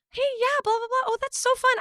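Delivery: mock a gossip fishing for info; fake friendly. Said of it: "they would be like".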